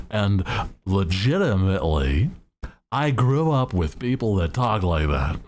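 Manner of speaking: ordinary talk, nothing marked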